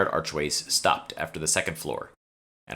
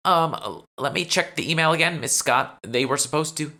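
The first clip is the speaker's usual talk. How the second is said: much higher, louder nervous assistant voice; nervous lackey; 11 semitones higher, 5 dB louder than usual. Acted. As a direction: stammer like an overworked lackey. Fake lackey answering her boss.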